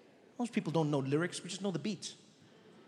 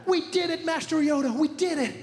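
put-on much higher cheering young hero; high excited hero; 10 semitones higher, 3 dB louder than usual. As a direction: celebrate the win. Triumphant hero.

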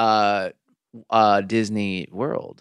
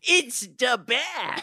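high, slow laughing Brooklyn drawl; Brooklyn accent; 13 semitones higher, 4 dB quieter, much slower than usual. Retold or imitated. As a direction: stretch it out in Brooklyn. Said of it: "with a Brooklyn accent said".

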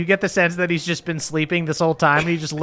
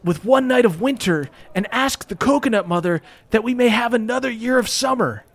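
lightly raised ape king voice, solemn; a hint of a ceremonial ape king speech; Donkey Kong voice; 6 semitones higher than usual. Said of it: "Donkey Kong nods and he goes".

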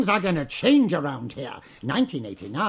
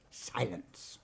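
cold quiet command; 7 semitones lower, 13 dB quieter than usual. Acted. Manager demands silence.

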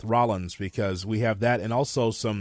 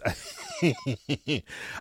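soft, breathy run of chuckles; laugh; 3 semitones higher, 6 dB quieter than usual. Real, not acted. Co-host amused by Drew McIntyre's position, soft chuckle. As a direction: chuckle softly before carrying on. Laughs while starting an answer.

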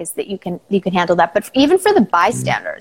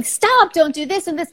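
mock yell; 8 semitones higher than usual. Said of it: "people around are screaming and yelling".

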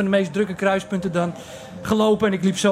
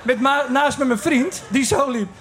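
ordinary talk, nothing marked

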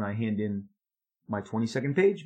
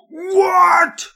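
a huge, high, piercing scream; screamed what; 14 dB louder than usual. Retold or imitated. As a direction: explode with a shrill scream. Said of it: "screams at me".

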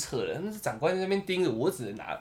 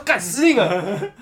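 panicked laugh; 4 semitones higher, 5 dB louder, slower than usual. Retold or imitated. Panicked inner outburst, laughing.

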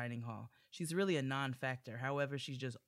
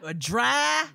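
mock outrage; 8 semitones higher, 12 dB louder than usual. Mock outrage at dry cake.

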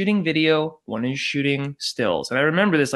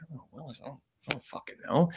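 soft, hurried mumble to oneself; retold baffled aside; clueless muttering; 8 dB quieter, much faster than usual. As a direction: mutter it under your breath, at a loss. Caught clueless at interview, retold.

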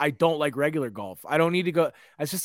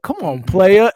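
louder, higher taunt; mock trash talk; 5 semitones higher, 9 dB louder, slower than usual. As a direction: needle the player on the court. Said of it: "They're like".

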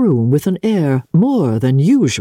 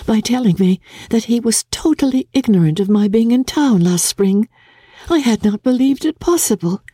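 put-on a gentle young lady's voice, a touch higher; lightly voiced Jane; 9 semitones higher than usual. Jane confiding in her sister, lightly voiced young lady.

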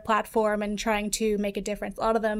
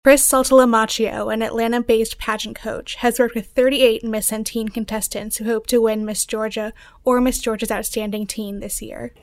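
news read; 7 dB louder than usual. Acted. Reporter reads scripted story.

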